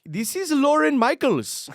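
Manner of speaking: high, slow put-on Indian accent; imitating a scam caller's accent. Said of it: "Can't even do that accent".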